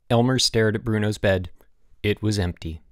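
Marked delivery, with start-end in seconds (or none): none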